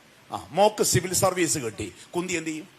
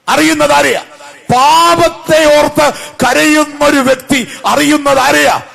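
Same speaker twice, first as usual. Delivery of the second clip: loud, high, ringing sermon cry; impassioned preacher, raised pitch and big volume; preaching at full force; 7 semitones higher, 9 dB louder than usual. Real, not acted. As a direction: drive each question out to the whole congregation.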